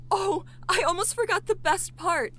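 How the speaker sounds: sudden excitement. Acted. Rebecca suddenly remembering the clinic's best feature, excited gasp. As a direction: she just remembered the best part and can't wait.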